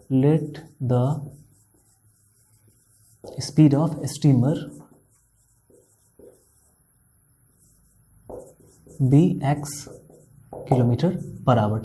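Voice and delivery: slow, word-by-word dictation. Shown as a demonstration. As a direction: dictate each word slowly for writing. Teacher dictating problem slowly. (धीरे-धीरे लिखवाते हुए)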